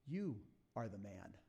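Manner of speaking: grave, hushed prophet's accusation; Nathan's accusation. Retold or imitated. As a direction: let the verdict land quietly and gravely. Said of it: "Then Nathan said to David".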